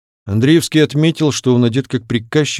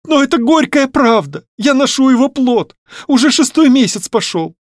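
very high-pitched anguished outburst; distraught daughter confessing to her father; tearful confession; 13 semitones higher, 3 dB louder than usual. A narrator's reading. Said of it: "упала на грудь обожаемого отца и зарыдала". (отчаянно, со слезами)

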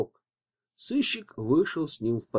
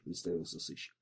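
ordinary delivery

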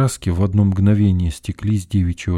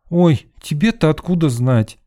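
put-on high, animated girlish exclamation; exclaiming; 9 semitones higher, 4 dB louder than usual. A narrator's reading. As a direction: exclaim it in lively disbelief. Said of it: "воскликнула Лена".

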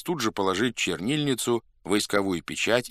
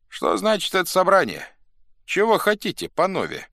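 high, raised questioning voice; calling to a crowd; 11 semitones higher, 4 dB louder than usual. A narrator's reading. Chief asks the assembled Cossacks, calling out high. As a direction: address the whole square.

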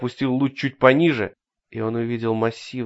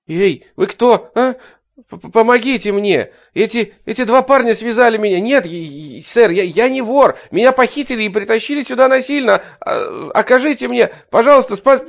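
high, loud desperate begging; terrified pleading hostage; 10 semitones higher, 7 dB louder than usual. Acted. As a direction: plead for rescue in terror. Bound man begging stranger. (отчаянная испуганная мольба)

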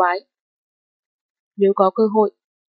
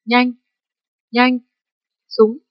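frantic, flustered; 3 semitones higher, 4 dB louder, much slower than usual. A narrator's reading. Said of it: "gấp đến độ nói năng lộn xộn".

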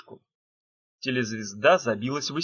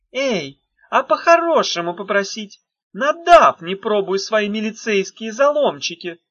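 merry loud witch yell; cheerful shout; 8 semitones higher, 7 dB louder than usual. A narrator's reading. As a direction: shout it out gleefully. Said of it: "весело закричала ведьма".

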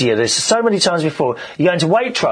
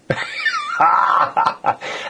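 burst of laughter. Real, not acted. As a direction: let a big laugh burst out. Reacting to hugging the manager.

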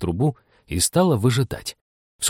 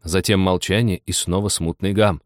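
usual speaking voice

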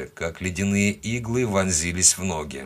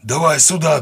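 high, loud call from shore; distant shout; 7 semitones higher, 6 dB louder than usual. A narrator's reading. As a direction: call across the water. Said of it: "донеслось с берега". (громкий крик с берега)

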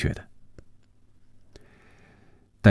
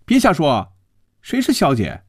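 high-pitched indignant retort; huffy woman's rebuke; testy, cross; 9 semitones higher than usual. A narrator's reading. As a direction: snap back in an annoyed high voice. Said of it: "没好气儿说".

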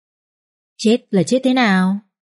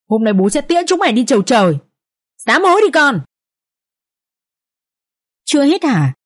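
shouting. A narrator's reading. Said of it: "rồi hét lớn".

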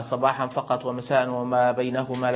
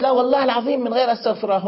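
put-on high, loud, fast little girl's voice; childish oath; 10 semitones higher, 6 dB louder, faster than usual. Father imitating little daughter swearing an oath, high and fast. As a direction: squeak it out fast like an insistent small child.

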